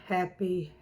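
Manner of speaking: ordinary delivery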